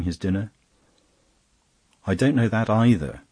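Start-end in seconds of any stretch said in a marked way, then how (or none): 2.1-3.2 a touch of bewilderment. A narrator's reading. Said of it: "with a face of blank bewilderment".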